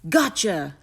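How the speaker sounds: triumphant, punchy boom; booming giant. A narrator's reading. Giant catching a terrified Jack, booming. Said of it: "boomed the giant".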